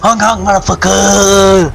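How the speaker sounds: slow, loud mock honking shout; drawn-out clownish yell at a driver; silly clown. Wants to honk at driver.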